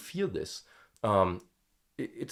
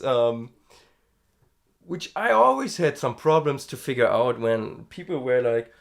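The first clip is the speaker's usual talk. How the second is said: ordinary talk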